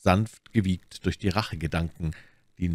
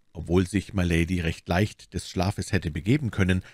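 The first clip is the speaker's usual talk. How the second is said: ordinary delivery, nothing marked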